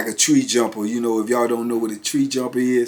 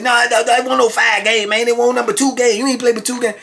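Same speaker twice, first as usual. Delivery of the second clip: much higher-pitched mock protest; indignant denial; 10 semitones higher than usual. Beaten chess player denying his losses to the winner, high indignant imitation. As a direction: argue the score in a high, worked-up voice. Acted loser disputing chess score.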